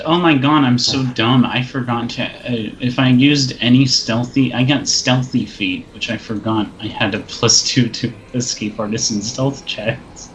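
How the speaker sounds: ordinary talk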